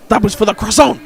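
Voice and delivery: put-on shouted croissant grievance in a Belgian accent; aggressive Belgian accent. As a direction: confront them in an indignant Belgian accent. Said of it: "slightly aggressive, um, Belgian accent".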